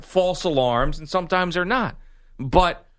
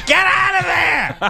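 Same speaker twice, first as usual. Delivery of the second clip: high shrill shout, higher and louder; angry voicemail; 5 dB louder than usual. Retold or imitated. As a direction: yell it down the phone at her. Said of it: "leaving angry voicemails to her".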